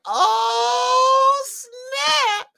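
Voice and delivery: very high, drawn-out mock swoon; awestruck excitement. Smitten boy hears his Southern cousins' accents, high excited squeal.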